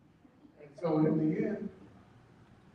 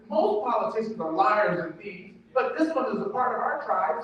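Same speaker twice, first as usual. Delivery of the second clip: usual speaking voice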